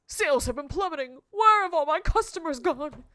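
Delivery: loud, theatrical shopkeeper's lament; complaining shopkeeper. Acted. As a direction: wail it out as a hammed-up complaint.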